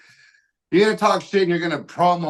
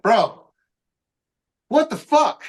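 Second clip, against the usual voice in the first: much higher, louder cry of disbelief; exasperated outburst; 7 semitones higher, 4 dB louder, slower than usual. Retold or imitated. Venting to his homie after the company shut down, exasperated. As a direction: throw up your hands, bro.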